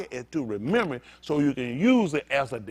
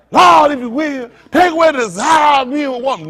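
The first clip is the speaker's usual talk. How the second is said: mock preacher crying out, higher and loud; mock loud prayer; 9 semitones higher, 12 dB louder than usual. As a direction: shout it to the Lord like an over-the-top pray-er. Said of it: "I don't even talk like that".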